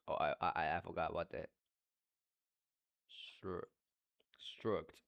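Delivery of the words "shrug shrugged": ordinary delivery, nothing marked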